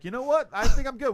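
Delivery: loud, high-pitched imitation of a reluctant patient; mock backing out. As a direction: play a patient suddenly talking himself out of treatment. Acting out burned guy backing out.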